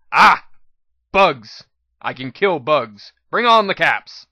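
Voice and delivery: loud, gleeful outburst at the bugs; eager shout. A narrator's reading. Narrator cutting Glory off with a stomp, shouting eagerly. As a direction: bellow it with blunt delight. Stomps hoof, cuts her off.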